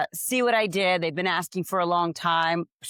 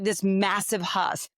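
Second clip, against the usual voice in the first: ordinary delivery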